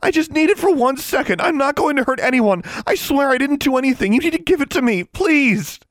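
shrieking high, loud plea; desperate begging. A narrator's reading. Girl begging the custodian, hysterically pleading. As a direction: beg frantically, rising to hysteria. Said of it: "she begged".

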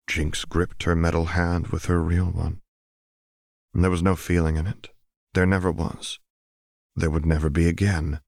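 ordinary delivery

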